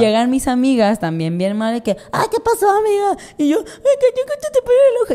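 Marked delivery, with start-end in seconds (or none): 2.1-3.2 high-pitched fussing friends; worried friends. Retold or imitated. 3.6-5.1 high sobbing wail; mock crying. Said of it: "Y empecé a llorar".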